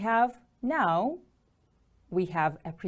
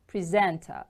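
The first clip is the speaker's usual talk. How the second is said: "Presenter" with the stress on SENT; second-syllable stress. Shown as a demonstration. Teacher models the word for repetition, "Presenter" stressed on SENT. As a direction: lean on the middle of "Presenter": pre-SENT-er. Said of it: "The stress is on the second syllable".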